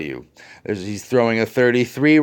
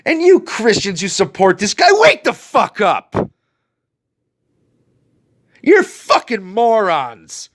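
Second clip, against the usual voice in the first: real anger, pitched up; angry; 9 semitones higher than usual. Real, not acted. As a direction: lash out at them. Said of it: "if I seem angry this morning".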